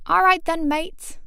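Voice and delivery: high, loud, badly faked British cheer; cheery mock-British voice; rubbish British accent. A narrator's reading. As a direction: put on a hammed-up British accent. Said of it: "doing a rubbish British accent".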